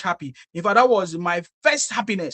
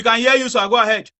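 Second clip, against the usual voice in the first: louder reassurance into a bad line; to someone hard of hearing; 3 semitones higher, 7 dB louder than usual. Real, not acted. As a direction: speak up so the caller hears. Caller keeps asking if heard.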